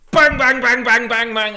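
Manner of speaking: loud, high rapid-fire bangs; mimicked gunfire. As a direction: blast out the shots like a machine gun. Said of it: "pulls out an A K forty-seven and goes".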